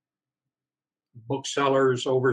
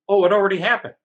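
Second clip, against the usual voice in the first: higher, louder, offhand mock rep voice; breezy mock publisher; 7 semitones higher, 8 dB louder, much faster than usual. Publisher rep breezily brushing off the author, higher and louder. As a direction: toss it off like it's no big deal.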